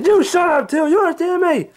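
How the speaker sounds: put-on high, loud, cranky old man yelling; Angry Grandpa voice. Old man woken by pranksters, high cranky yelling. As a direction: bark at the intruders like a furious grandpa.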